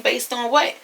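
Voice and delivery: mock-baffled challenge; imitated incredulous challenge. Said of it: "She's like".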